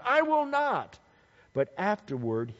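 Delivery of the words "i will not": high, acted-out refusal; stubborn son saying no; defiant refusal. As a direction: refuse outright, pitched right up. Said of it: "and he answered".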